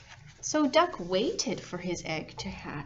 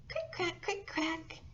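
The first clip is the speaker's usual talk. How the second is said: high, soft hatching sound; a gentle high eggshell crackle for the kids; high soft crick crack; 8 semitones higher, 8 dB quieter than usual. A narrator's reading. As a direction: tap it out high and light like a cracking shell. Egg hatching sound effect.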